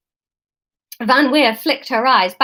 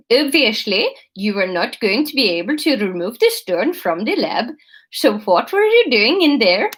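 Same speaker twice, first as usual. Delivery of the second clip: put-on slipping Dutch accent.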